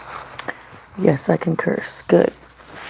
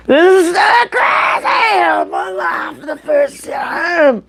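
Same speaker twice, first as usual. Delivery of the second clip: high, thrilled squeal, slowed right down; excited shout; 13 semitones higher, slower than usual. Real, not acted. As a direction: shout it out, overjoyed and giddy. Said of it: "I'm super excited".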